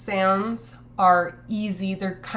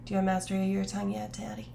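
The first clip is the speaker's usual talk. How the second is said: quick, breathy suggestive tease; suggestive joke voice; 5 dB quieter, faster than usual. Acted. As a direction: toss it off as a cheeky innuendo. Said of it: "That's what she said".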